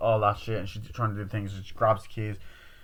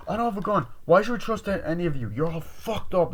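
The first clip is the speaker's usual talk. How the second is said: agitated protest; 8 semitones higher than usual. Retold or imitated. Accused girl rejecting the others, agitated high protest. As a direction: protest to the group, pitched up. Acted quarrel over trust.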